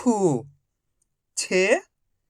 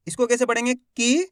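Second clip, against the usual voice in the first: usual speaking voice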